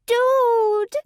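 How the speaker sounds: very high, loud squeal; high nasal shriek. A narrator's reading. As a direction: squeal in panic like a tiny creature. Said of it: "two octaves higher and painfully nasal".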